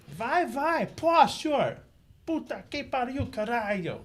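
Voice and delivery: very high, loud Brazilian coach yelling; Luis coaching impersonation. Shown as a demonstration. Impersonating coach Luis yelling in Portuguese, loud high cursing. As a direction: curse and urge like a fired-up corner coach. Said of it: "I have a decent Luis coaching impersonation".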